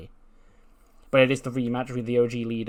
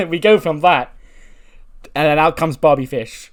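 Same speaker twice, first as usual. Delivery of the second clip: loud, high hype over the entrance; thrilled reaction to a surprise entrance; excited; 5 semitones higher, 11 dB louder than usual. Real, not acted. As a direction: announce it like big news.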